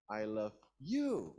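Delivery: slow, landing hard on "you"; stress on YOU. Shown as a demonstration. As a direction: save the emphasis for the last word, "you". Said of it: "I'm using emphasis".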